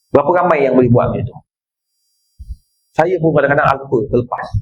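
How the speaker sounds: normal talking voice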